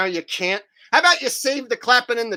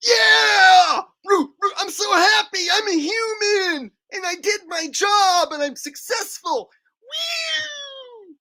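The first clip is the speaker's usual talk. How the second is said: much higher, loud sobbing yells of joy; hammed-up tearful cheering; 12 semitones higher, 3 dB louder, slower than usual. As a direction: overdo the crying triumph, mocking. Mock overjoyed sobbing celebration.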